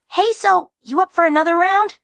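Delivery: high, eager teasing Jax voice; voicing Jax. Narrator mimicking Jax inviting a friend to another round. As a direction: pitch it up, cocky invitation.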